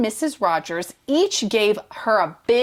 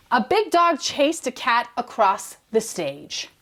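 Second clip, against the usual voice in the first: ordinary delivery, nothing marked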